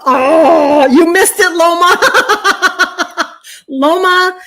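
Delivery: high, loud, laughing gush; real giddy laughter to a viewer; laughing excitement. Real, not acted. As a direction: spill it out, laughing. Gushing about an emotional day.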